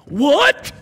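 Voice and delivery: high, loud incredulous What; sudden hammed-up gasp of disbelief; mock shock. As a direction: play a stranger jolted by the offer. Imitating shocked listener.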